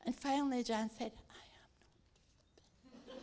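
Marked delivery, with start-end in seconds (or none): 1.3-3.2 barely audible, drawn-out whisper. Retold or imitated. Jan refusing on the train, whispering. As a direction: refuse in a slow, voiceless whisper. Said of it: "we are doing this whispering".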